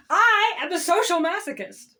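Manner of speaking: ordinary talk, nothing marked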